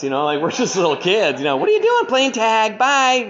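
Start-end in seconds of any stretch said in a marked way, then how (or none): 1.6-3.3 put-on innocent kid voice. Kids caught sneaking, innocent dodge.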